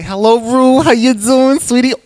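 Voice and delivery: high, loud Bronx character voice; Bronx Medusa. Acted. Medusa from the Bronx greeting the show's host, high and loud. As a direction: greet them as a big, pushy Bronx dame. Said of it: "I'm gonna do Medusa, but she's from, like, the Bronx".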